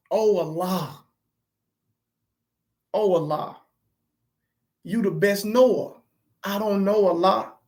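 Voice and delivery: low, soft murmured prayer to Allah; hushed prayer. Retold or imitated. Father in shock quietly calling on Allah, murmured. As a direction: pray under your breath in shock. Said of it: "all I just kept saying is".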